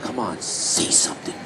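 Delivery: low, slow unvoiced whisper; whispered taunt. Quietly urging the worker to say something, whispering. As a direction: hiss it under your breath as a dare. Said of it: "I was like".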